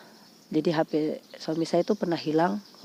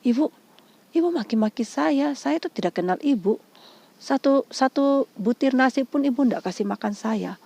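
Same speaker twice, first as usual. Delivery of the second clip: raised pitch, re-enacted protest; indignant retort; 6 semitones higher, 4 dB louder than usual. Woman tells abusive caller off, re-enacted indignation. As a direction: protest the insults, higher and firmer.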